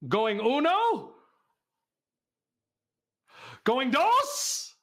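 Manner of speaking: high, drawn-out rising call; auctioneer's call. Pretend. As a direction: tease it out like an auctioneer. Auctioneer call in another language.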